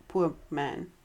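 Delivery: usual speaking voice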